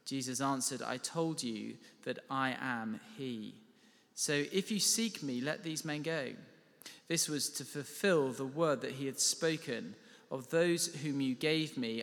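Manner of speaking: ordinary delivery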